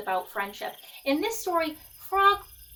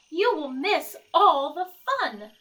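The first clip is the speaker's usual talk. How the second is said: higher, louder plea; cried out; 5 semitones higher, 5 dB louder than usual. Acted. Frog crying out to Toad under the covers, a loud high plea. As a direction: Frog crying out to wake his friend. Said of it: "cried Frog".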